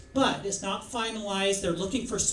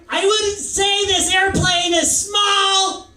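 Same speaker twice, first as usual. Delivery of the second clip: high, loud, strident comic shout; Gilbert Gottfried; 11 semitones higher, 10 dB louder than usual. Acted. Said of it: "Gilbert Gottfried".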